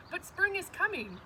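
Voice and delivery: high bright chirp of a little bird; chirpy. Acted. Bird chirping at the grumpy rabbit, high and light. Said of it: "Bird chirped".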